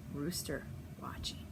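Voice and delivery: whispered rooster watching; hushed aside. Acted. Teacher pointing out the rooster, whispering. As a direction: drop to a whisper.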